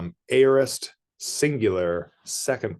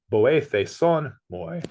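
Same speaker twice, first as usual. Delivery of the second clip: ordinary delivery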